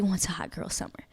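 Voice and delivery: breathy secret whisper. Real, not acted. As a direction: lean in and whisper it. Sharing a secret about wanting.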